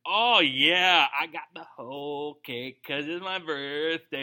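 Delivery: slow, gloating voice savouring the cake; mock cake glee. Preacher plays a churchgoer gloating over his birthday cake. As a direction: relish the whole cake like a kid on his birthday. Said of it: "You're like".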